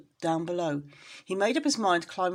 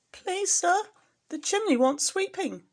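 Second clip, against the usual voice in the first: much higher, small pleading kitten voice; timid kitten; 13 semitones higher, 3 dB louder than usual. Acted. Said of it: "said poor Tom Kitten".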